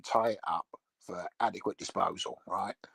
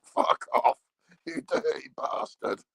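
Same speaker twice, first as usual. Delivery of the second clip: disgusted telling-off; 14 semitones higher, slower than usual. Retold or imitated. Disgusted caller.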